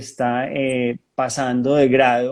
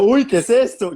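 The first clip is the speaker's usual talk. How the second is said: high, startled gasp of surprise; high startled "what is this"; 7 semitones higher, 5 dB louder than usual. Retold or imitated. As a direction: recoil in sudden alarm. Reacting in shock to possession.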